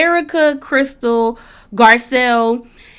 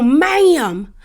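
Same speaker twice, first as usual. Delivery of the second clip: imitating Sutton's ma'am; 5 semitones higher than usual. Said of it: "the thing about the whole".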